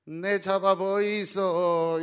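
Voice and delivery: loud, slow, high Gyeongsang dialect; Gyeongsang wife. Retold or imitated. Gyeongsang wife teasing her husband, loud in dialect. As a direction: bellow it in dialect. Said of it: "경상도 아내가".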